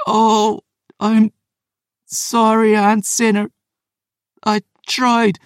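very high, halting cry of pain; agonised apology. Acted. Poisoned Phoebe apologises to her aunt, agonised. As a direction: wail it in delirious agony.

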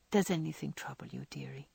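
soft, tender and a little quick; tenderest tone. Acted. Joe tenderly asking sick Beth what troubles her, soft. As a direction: coax her gently, fading to a hush. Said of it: "in her tenderest tone".

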